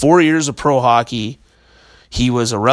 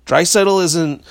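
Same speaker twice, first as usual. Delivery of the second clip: ordinary talk, nothing marked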